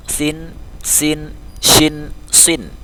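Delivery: "Sin, sin, shin, sin": lisped, hissed, blown, then rounded; held "Sin, sin, shin, sin", each s distinct; contrasting sibilant letters. Shown as a demonstration. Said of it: "shin dia agak dihembuskan".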